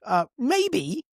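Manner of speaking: hammed-up sarcastic parents, high and drawn out; sarcastic realization. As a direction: act like it just dawned on you. Parents mocking obvious connection.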